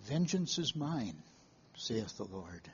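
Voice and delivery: soft, hushed, falling away to almost nothing; hushed scripture quote. Acted. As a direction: read it as a quiet, reverent quotation. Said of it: "from your mind comes the word".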